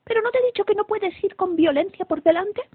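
high, loud exasperated mother; indignant queen telling off her daughter; scolding shout. A narrator's reading. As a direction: scold your child in a high voice. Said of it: "gritó la reina".

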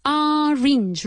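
"Orange" with a high push on OR; stress on the first syllable. Shown as a demonstration. Teacher correcting a learner's word, first syllable pushed up. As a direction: lift and hit OR in "Orange". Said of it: "Emphasis on the first syllable".

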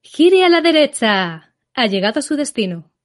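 GPS voice. Shown as a demonstration. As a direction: read it like a car sat-nav. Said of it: "Por ejemplo".

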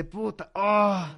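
slow, drawn-out groan of remorse; mock moral-hangover lament; hungover regret. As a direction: moan as if waking to last night's spending. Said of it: "podríamos despertar con cruda moral".

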